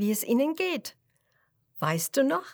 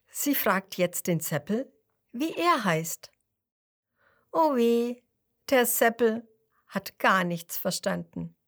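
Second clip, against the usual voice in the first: ordinary delivery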